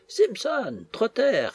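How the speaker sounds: high-pitched angry summons; furious calling out. A narrator's reading. Mistress summoning her idle servants, furious. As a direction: call the servants sharply, voice leaping up. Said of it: "cria la maîtresse de la maison au comble de la fureur".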